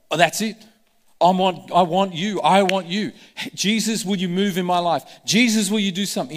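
loud pleading call to Jesus; acted out loud plea; desperate cry. Bartimaeus crying out to Jesus.

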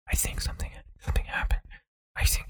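whispered imitation of muffled movie audio; barely audible dialogue. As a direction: whisper it as if the mic can't catch you. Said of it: "all you hear is".

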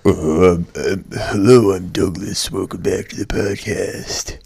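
deep, slow, growly morning voice; Douglas's morning voice. Retold or imitated. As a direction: growl it like just woke up. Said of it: "really deep and growly".

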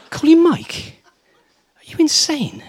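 high-pitched mock incredulity; aghast disbelief. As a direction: imitate an appalled senior barrister, voice leaping up. Senior barrister aghast at the request.

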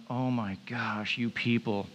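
imagine a fed-up teacher despairing under their breath; hammed-up condescending disbelief; mock annoyed. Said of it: "He's so annoyed right here".

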